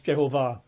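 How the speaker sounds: Jehovah said je-ho-VAH; Jehovah with an open, stressed ending; stress on last syllable. Shown as a demonstration. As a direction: put the weight on the final "vah" of Jehovah. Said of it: "with the emphasis on the last syllable".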